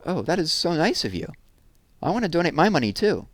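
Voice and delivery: moved by her friend's kindness; touched Megan. Acted. Megan touched by Jessie's donation plan, sweetly.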